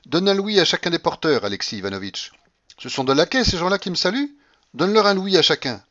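high, loud commanding grandmother; imperious old grandmother. Acted. Grandmother orders tips for the porters, imperious. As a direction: order them about loudly.

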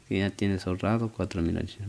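mock patrona writing; quiet dictation. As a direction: murmur it back low as you write. Boss repeats dictation while writing.

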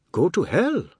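heated quarrelling voice; angry retort. A narrator's reading. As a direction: throw it back furiously. Furious retort in family quarrel.

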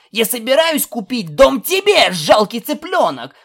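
high, loud insulting comeback; furious shout. A narrator's reading. Colt threatens filly with a house, furious shout. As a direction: outshout the other in a high voice. Quarrel escalates into threat of gift.

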